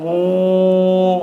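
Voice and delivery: long, steady humming airplane noise; imitated plane drone. Preacher imitates a plane passing over the village, drawn-out drone.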